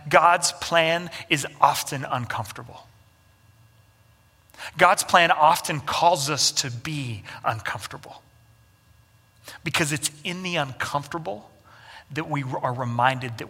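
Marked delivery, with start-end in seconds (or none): none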